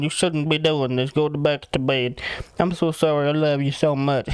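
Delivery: put-on slurred, pleading drunk voice; mock pitiful drunken whine; imitating drunk apology. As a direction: play a wasted husband fussing over his wife. Drunk, apologising as wife cleans.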